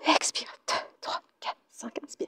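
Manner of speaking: very soft, whispered beats; whispered count. Real, not acted. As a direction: breathe out the numbers. Counting breaths during the exercise.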